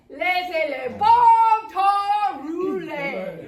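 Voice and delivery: loud, slow, drawn-out holler. Acted. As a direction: shout it far down the street. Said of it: "You could hear him say".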